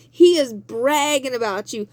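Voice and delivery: ordinary talk, nothing marked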